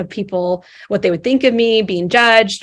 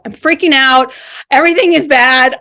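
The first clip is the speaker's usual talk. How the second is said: louder, panicky burst on freaking; 4 semitones higher, 6 dB louder than usual. Retold or imitated. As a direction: let the panic break through, louder.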